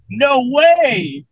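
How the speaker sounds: high, drawn-out "no way"; mock disbelief. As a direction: stretch it high, incredulous. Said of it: "It's like".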